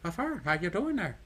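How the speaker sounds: high, bouncing mock Irish lilt; badly faked Irish, swinging upward; cheery fake Irish singsong; bad Irish accent. Shown as a demonstration. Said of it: "an Irish accent".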